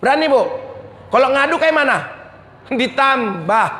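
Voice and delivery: ordinary talk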